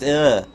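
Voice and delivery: short annoyed "Ugh" groan; exasperated little groan. Retold or imitated. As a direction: groan like the voice grates on you. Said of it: "It just".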